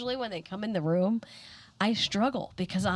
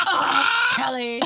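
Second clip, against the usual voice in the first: loud, high "oh" falling into reproach; mock groan, cracking up; exasperated husband; 6 semitones higher, 9 dB louder, slower than usual. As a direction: imitate a fed-up spouse through laughter. Husband exasperated, retold laughing.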